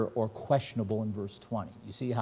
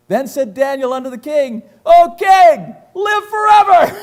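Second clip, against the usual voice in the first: jubilant proclamation; 13 semitones higher, 15 dB louder than usual. Acted. Daniel's jubilant greeting to king.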